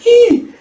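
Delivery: very high, loud acted squeal; short shrieked "ee"; scream. Taejun shrieking as his secret is exposed, high scream. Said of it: "he screams with an".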